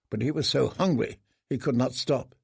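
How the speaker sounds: usual speaking voice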